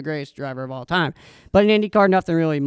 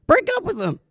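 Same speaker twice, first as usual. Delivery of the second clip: emphatic; 12 semitones higher, 4 dB louder than usual. Real, not acted. Punchline urging listeners.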